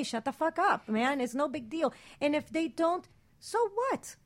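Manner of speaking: ordinary talk, nothing marked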